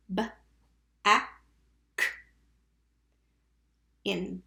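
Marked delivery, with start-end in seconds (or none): 0.1-2.1 "b, a, k" as three isolated letter sounds; sounded out. Shown as a demonstration. Teacher tapping back with pupils, sounded out. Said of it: "tap back".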